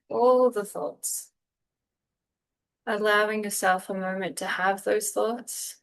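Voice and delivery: unhurried meditation guide with long pauses; calm, spacious guiding voice; guided meditation. Real, not acted. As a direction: ease them gently through breath and body, phrase by phrase.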